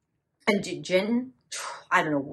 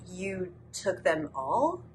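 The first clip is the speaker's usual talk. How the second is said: slow, softer incredulous question; imitated disbelief; 6 dB quieter, slower than usual. Roommate learns every knife is gone, slow imitated disbelief.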